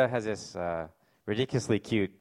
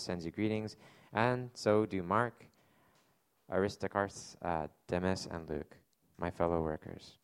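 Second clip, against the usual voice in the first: measured, steady public reading of scripture; 3 semitones lower, 5 dB quieter than usual. Acted. Pastor reading Philemon aloud to his church, in an unhurried scripture-reading voice. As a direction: read the letter aloud to the congregation.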